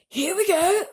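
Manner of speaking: put-on old grasshopper whooping with excitement; shouted. A narrator's reading. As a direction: yell it as the floor heaves. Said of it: "shouted the old grasshopper".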